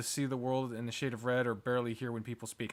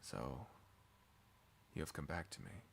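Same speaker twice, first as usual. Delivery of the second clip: low, hushed figure's voice; deep quiet welcome; figure greeting a returnee; 8 semitones lower, 14 dB quieter than usual. Acted. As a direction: speak softly and very low.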